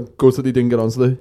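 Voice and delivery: normal talking voice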